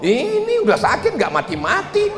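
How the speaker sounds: a lightly voiced fed-up wife; mock complaining wife. Preacher mimics a wife grumbling beside her comatose husband, mock voice.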